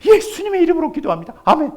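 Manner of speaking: frantic high-pitched pleading prayer; man on the roof praying hard as the flood rises, loud and pleading; desperate prayer. Acted. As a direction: beg God loudly to be saved. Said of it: "열심히 기도하는 거예요".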